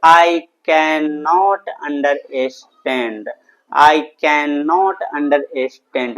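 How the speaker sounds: very slow, each word stretched out and separated; slow model sentence. Shown as a demonstration. English teacher giving students the answer, slowly and deliberately drawn out. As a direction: dictate it word by word for learners.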